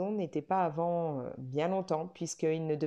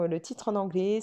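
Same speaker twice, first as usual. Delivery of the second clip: ordinary talk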